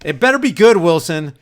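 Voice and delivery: ordinary talk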